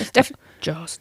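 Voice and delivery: hushed mock-secret tip to the guest; conspiratorial whisper. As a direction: whisper it slow and sly, a playful aside. Joking aside to sway the guest.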